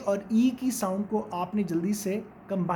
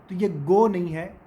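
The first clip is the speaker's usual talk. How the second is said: usual speaking voice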